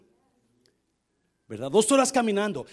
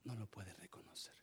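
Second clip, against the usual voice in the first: much softer, barely voiced "No lo pueden reconocer"; hushed repeat; 9 semitones lower, 23 dB quieter than usual. Shown as a demonstration. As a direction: drop to a hush on the echo. Repeats line, second much softer. (repetición en voz muy baja)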